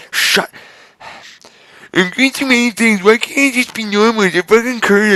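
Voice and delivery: high-pitched whiny rant; shouted. Acted. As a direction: whine it at the world. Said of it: "I shouted that right away".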